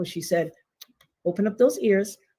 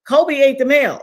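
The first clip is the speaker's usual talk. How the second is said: high, loud mock-panicked excuse; 7 semitones higher, 8 dB louder than usual. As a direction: blurt it out like a guilty caller. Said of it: "they call".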